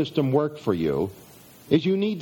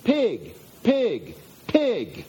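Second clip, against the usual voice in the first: mild mock yell; 7 semitones higher, much slower than usual. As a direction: imitate a woman yelling from a car window, held back. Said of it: "he hears that she's yelling".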